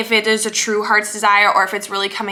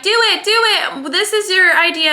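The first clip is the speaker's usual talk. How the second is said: higher, urging inner cheerleader; eager inner voice; 6 semitones higher than usual. Acted. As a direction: push yourself to just do it. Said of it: "this one's like".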